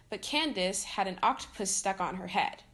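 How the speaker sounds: usual speaking voice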